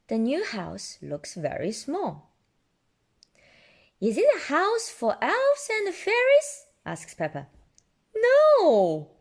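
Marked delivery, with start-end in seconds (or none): 4.0-6.6 high curious little pig; Peppa voice. Acted. Peppa asking Daddy about the tiny house, high voice. 8.1-9.1 high, loud amused no; chuckling no. Acted. Daddy Pig chuckling at Peppa's guess, loud high. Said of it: "chuckles Daddy Pig".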